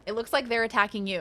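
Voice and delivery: ordinary delivery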